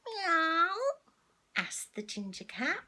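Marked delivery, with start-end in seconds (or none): 0.1-0.8 high questioning meow; a curious cat's mew. Acted. Ginger cat questioning Missus Jolly, rising meow. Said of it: "I needed my cat voice there".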